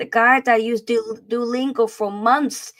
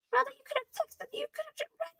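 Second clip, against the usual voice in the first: very high, softer squeal; mock exasperated whine; 8 dB quieter than usual. Host complains about a cryptic text in a high, mock whine.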